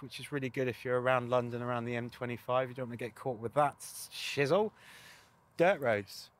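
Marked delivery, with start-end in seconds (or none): none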